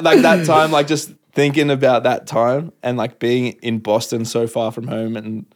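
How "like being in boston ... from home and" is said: normal talking voice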